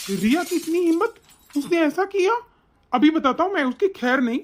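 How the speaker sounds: high-pitched indignant disbelief; outraged anger. Acted. Son hearing that his wife hurt his mother, outraged. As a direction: rise up in shocked outrage.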